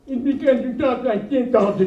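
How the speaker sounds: loud voice of a deaf man; talking loud. Retold or imitated. Best friend imitating the comic, loud. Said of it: "And he goes".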